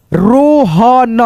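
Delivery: Rohan very slowly split as रो, ह, न; drawn-out teaching version of Rohan; syllable-by-syllable reading. Shown as a demonstration. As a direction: stretch out every sound of Rohan. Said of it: "Hindi पढ़ना लिखना".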